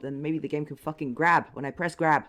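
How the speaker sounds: furious yell at the game; angry shout. Real, not acted. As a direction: shout it in rage. Raging at the game.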